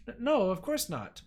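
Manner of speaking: slow, stammering denial; a nervous girl caught out; flustered stammer. Acted. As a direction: stutter out a scared no. Flustered under scolding.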